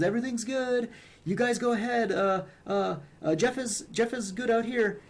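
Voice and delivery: chirpy, as if he were one of the gang; mimicking goblins. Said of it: "he sort of mimics them".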